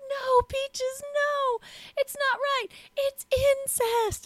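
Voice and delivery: high, shrill-free mock yell of a scandalized mom. Mother catching her daughter at the party, mock yell. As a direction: shriek it like a shocked mother. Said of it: "And she, like, yells it out".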